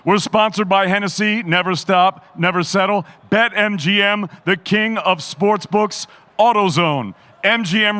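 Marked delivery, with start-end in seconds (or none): none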